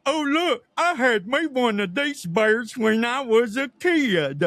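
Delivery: put-on high, loud, dopey Southern accent; Southern truck driver. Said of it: "this is exactly how he sounds".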